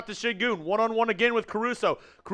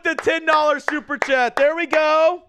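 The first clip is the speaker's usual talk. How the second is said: loud, high hype, first word drawn out; excited shout-out; 6 semitones higher, 9 dB louder, slower than usual. Real, not acted. Streamer thanks a viewer for a super chat, excited holler. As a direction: holler the donor's name in celebration.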